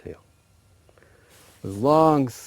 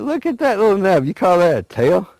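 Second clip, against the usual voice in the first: high, loud mocking jeer; acted scornful taunt, loud and high; making fun; 10 semitones higher, 9 dB louder than usual. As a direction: ridicule it in a raised voice. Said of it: "used to always make fun of him".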